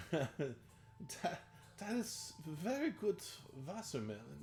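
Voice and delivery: soft German accent, lifted in pitch. Shown as a demonstration. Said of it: "I'm trying not to do the typical".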